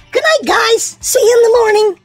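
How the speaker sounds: ordinary delivery, nothing marked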